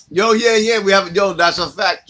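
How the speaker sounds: loud, high, hyped; excited greeting. Real, not acted. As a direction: shout it out, all hype. Friend [name] arrives, loud greeting.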